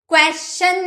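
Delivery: क्वेश्चन without the "ch", as kwe-shun; क्वेश्चन ending in "shun", as the mistake; wrong SH pronunciation. Shown as a demonstration. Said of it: "क्वेश्चन नहीं".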